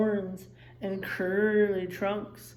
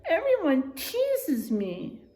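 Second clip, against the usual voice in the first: higher, slow, teary complaint; whimpering warthog; 7 semitones higher, slower than usual. A narrator's reading. Small warthog telling the old wildebeest he is teased, teary. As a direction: whine it out as if near tears.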